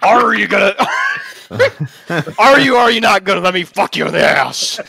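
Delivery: loud, laughing all through; loud laughing plea. Acted. Reading Nixon's demand aloud, laughing loudly. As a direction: shout it while cracking up.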